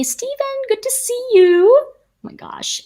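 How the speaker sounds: light up at seeing a friend; excited greeting. Real, not acted. To Steven in the chat, thrilled and high.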